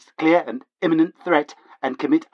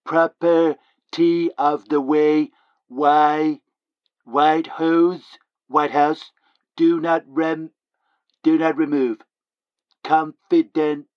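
slow reading; much slower than usual. Acted. Reading the sheet slowly.

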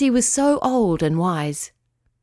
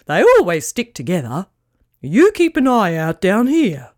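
louder, haughty but kind pelican voice; proud pelican; 3 dB louder than usual. Acted. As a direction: speak like a grand, dignified bird. Pelican character speaks.

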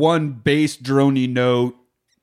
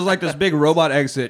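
normal talking voice

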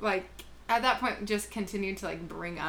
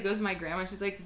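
ordinary delivery

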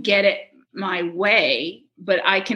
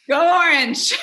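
mock cheer; 9 semitones higher, 4 dB louder than usual. Cheering team chant retold.

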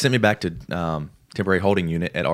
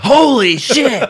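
high, loud shocked yelp; shocked exclamation; 11 dB louder, much slower than usual. Retold or imitated. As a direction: corpsman sees the knee and yelps, high and loud. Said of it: "He's like".

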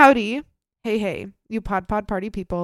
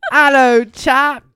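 loud, hammed-up fake British greeting; jokey bad British accent, much louder; 4 semitones higher, 13 dB louder, slower than usual. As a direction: overdo a cheesy British accent. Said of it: "chill with my bad accent".

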